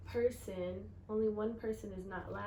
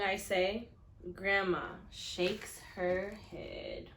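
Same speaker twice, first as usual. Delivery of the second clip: louder, higher storybook reading; expressive story reading; 4 semitones higher, 9 dB louder than usual. A narrator's reading. Teacher reading the kickball scene to her class, lively storytelling. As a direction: read it big for the class.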